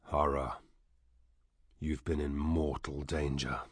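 low, soft voice of grave warning. A narrator's reading. Listener warning Hara of mortal danger, softly. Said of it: "also softly".

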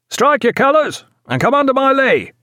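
high, loud hail across the water; brassy hail. A narrator's reading. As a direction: hail the ship in a ringing voice of brass. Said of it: "hailed her in a voice of brass".

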